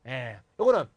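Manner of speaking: ordinary delivery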